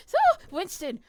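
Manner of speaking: loud, high call of a name. Real, not acted. Calling out loudly.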